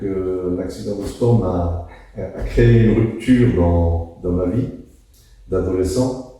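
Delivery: ordinary delivery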